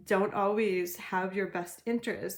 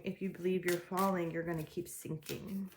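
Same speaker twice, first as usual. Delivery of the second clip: ordinary talk, nothing marked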